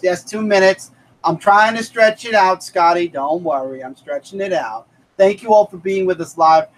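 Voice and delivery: usual speaking voice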